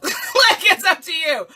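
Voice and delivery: giggling through the words; real laughter breaking into the words; laughing. Real, not acted. Laughing at own phoenix line.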